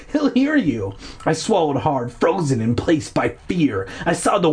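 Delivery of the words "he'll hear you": urgent warning. Acted. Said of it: "Will warned".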